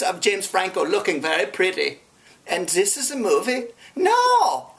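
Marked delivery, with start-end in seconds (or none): 4.0-4.7 outraged no. Acted. Outraged answer to his own question.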